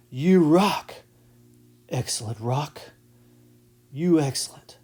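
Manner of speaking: a hint of a laid-back surfer dude drawling the mnemonic; lightly voiced surfer boy, easygoing; surfer boy accent. Shown as a demonstration. Teacher demos the mnemonic in a surfer boy accent. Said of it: "say it with kind of a, uh, a surfer boy accent".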